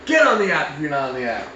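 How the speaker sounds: loud, fast plug, pitched high at the start; mock hard sell. Podcast host plugging the app as a joke, shouted sales pitch. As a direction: belt out a hammed-up promo. Jokey product plug called out.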